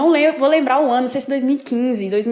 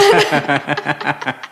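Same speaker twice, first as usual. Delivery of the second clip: real laugh breaking out, dropping low; burst of laughter; 11 semitones lower than usual. Real, not acted. Telling her pastor the horse story, bursting into a real laugh. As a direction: let a full laugh spill out.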